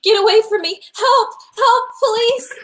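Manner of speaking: high, loud screaming for help; terrified scream. Acted. Frightened woman fighting off a stranger, screaming for help. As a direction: shriek for the police. Stranger grabs her, she calls out.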